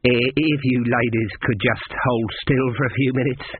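usual speaking voice

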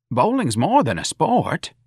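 high, slow protest; mildly defensive. A narrator's reading. Patrolman defending bowling to Mrs. Riley, defensive. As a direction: stand up for your pastime, pitched high. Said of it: "Patrolman Mancuso said defensively".

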